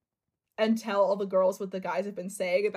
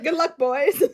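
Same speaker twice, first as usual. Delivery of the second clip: smug laughing farewell; 7 semitones higher, 8 dB louder than usual. Retold or imitated. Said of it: "He's like".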